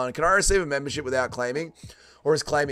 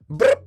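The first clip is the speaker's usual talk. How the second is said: short imitated computer blip; chat bot bloop; 6 dB louder than usual. Streamer mocking chat that treats him as a bot, imitated bloop. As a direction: pop out a quick electronic bloop.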